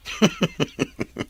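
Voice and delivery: breathy, voiceless chuckling; chuckle. Acted. Friend amused by talk of sponsoring Cherry, chuckling. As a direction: chuckle in a run of short puffs. Friend laughs before teasing question.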